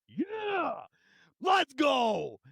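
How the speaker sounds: high excited whoop; high hype yell; hyped cheer. Real, not acted. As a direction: celebrate like a win. Hyped celebration.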